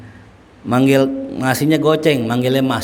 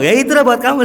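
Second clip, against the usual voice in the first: teasing reply; 11 semitones higher, 4 dB louder, faster than usual. Retold or imitated.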